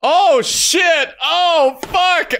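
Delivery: very high-pitched real yelp; startled exclamation. Real, not acted. Player reacts to a sudden surprise, startled.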